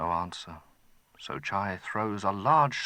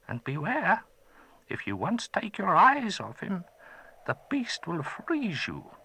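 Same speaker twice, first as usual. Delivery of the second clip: higher, put-on old man voice; a gentle old wizard, curious; old magician; 11 semitones higher than usual. Old man thanking the boy who freed him, gentle.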